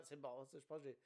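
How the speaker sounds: normal talking voice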